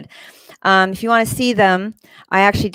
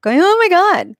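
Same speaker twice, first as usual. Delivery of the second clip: high, loud mock cry of victimhood; mock scream; 12 semitones higher, 6 dB louder than usual. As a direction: wail it out, mocking his panic. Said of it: "will run down the street going".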